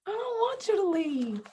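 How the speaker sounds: high, held lovesick whine; clingy pining. Acted. Telling partner not to go, high-pitched clingy plea. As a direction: stay up high, begging sweetly. Acts out lasting lovesick feeling.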